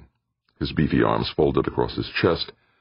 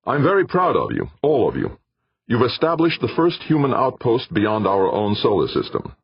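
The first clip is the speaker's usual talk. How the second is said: calling out proudly, higher; raised voice; 9 semitones higher than usual. Acted. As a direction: raise your voice to the crew. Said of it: "Jordan raised his voice".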